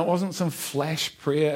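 normal talking voice

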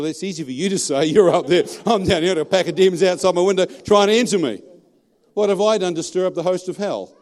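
loud, high-pitched pleading complaint; exasperated protest. Retold or imitated. Man protesting to God about demons outside his window, loud and exasperated. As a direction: raise the voice and argue upward in exasperation.

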